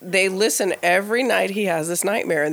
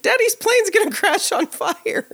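imitating a toddler; 5 dB louder than usual. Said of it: "he goes".

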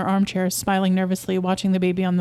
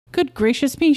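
a fussy mother suddenly alarmed; startled cry; 6 semitones higher than usual. Acted. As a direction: gasp it out as she jumps up. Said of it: "she cried, jumping up".

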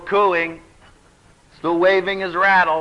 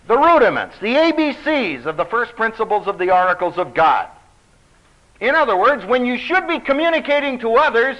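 raised, ringing preacher's voice; preaching; 7 semitones higher, 4 dB louder than usual. Acted. Preacher urging the congregation on, raised and ringing. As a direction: preach it out to the congregation, full force. Preacher reading and expounding scripture.

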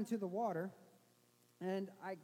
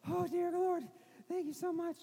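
relieved cry to God; 7 semitones higher, 3 dB louder than usual. Retold or imitated. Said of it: "plop down and be like".

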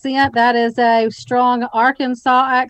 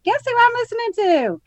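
excited; 13 semitones higher than usual. Retold or imitated. Excited text retold to friend.

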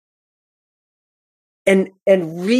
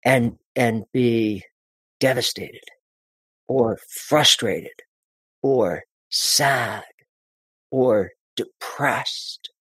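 lower, slow, heavy and sad; demo of going down; 5 semitones lower, 3 dB quieter, much slower than usual. Shown as a demonstration. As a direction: sink the voice to show devastation. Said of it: "we also need to go down".

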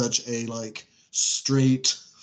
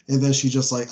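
ordinary delivery

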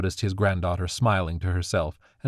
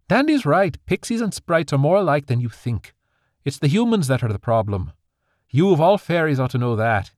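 louder, higher reproachful mother; firm rebuke; 7 semitones higher, 5 dB louder than usual. Acted. Daughter rebukes her father about humans, louder and firm. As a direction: put him in his place.

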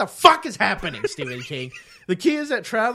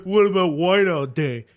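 mock complainer; 5 dB louder than usual. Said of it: "and they're like".

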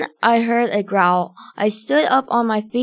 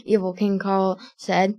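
ordinary delivery, nothing marked

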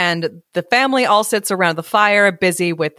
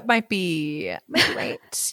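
whispered; 7 dB quieter, slower than usual. Real, not acted. Hushed teasing reply.

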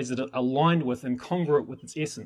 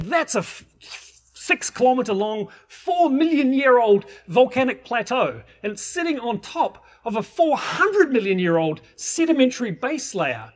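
louder, higher and slow, building amazement; awed excitement; 7 semitones higher, 5 dB louder, slower than usual. Retold or imitated. Mentor reveals the ancient volcanic plateau to students, slow excited awe. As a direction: spell out the vast scale with rising wonder. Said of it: "And he's saying".

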